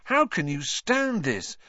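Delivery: restrained fierceness; fierce. A narrator's reading. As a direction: demand it intensely without shouting. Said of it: "he asked Hermione fiercely".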